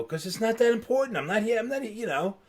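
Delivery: high-pitched retold excuse; mock-defensive, high and insistent; defensive protest. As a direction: argue back, pitched well up. Retold argument, protesting to teacher.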